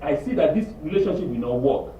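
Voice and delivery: usual speaking voice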